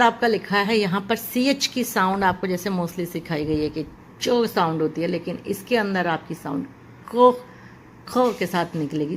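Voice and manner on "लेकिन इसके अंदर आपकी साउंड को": ordinary talk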